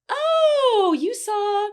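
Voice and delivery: high, loud drawn-out "oh" of recognition; imitated delighted recognition. Great-great-granddaughter recognizing the ghost, acted delighted surprise. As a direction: act out a neighbor's happy realization.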